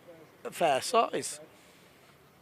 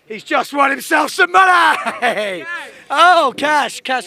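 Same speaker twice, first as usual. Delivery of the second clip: big, slow triumphant call, much louder and higher; excited shout; 8 semitones higher, 10 dB louder, slower than usual. Real, not acted. Commentator calling a rider's cash win, excited shout.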